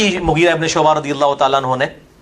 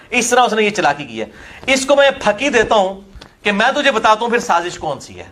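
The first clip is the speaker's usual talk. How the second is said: ordinary delivery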